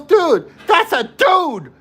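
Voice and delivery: high, loud shocked outburst. Retold or imitated. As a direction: blurt it out in shock. Friend shocked realization.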